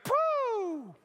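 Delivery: a high, loud burst of breath; sudden exclamation. Acted. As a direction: blurt it as if struck by a jolt. Jesus feels power leave him.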